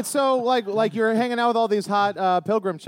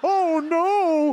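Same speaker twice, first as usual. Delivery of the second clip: Goofy voice; 7 semitones higher than usual. Pretend. Goofy voice bit.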